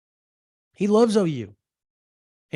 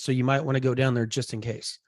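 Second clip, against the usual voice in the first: normal talking voice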